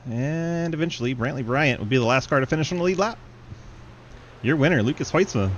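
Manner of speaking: fast live race call; play-by-play. Acted.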